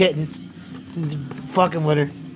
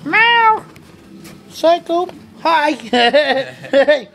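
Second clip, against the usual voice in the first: much higher, louder pet talk; cooing at a kitten; 8 semitones higher, 4 dB louder, slower than usual. Real, not acted. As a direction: call to the cat in a high sing-song.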